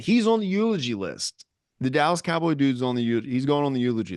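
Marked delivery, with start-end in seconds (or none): 0.0-1.5 higher, playful verdict; mock pronouncement. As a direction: announce it like a final sentence. Declaring offender on the list.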